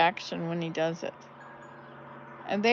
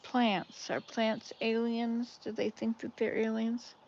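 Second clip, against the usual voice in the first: ordinary talk, nothing marked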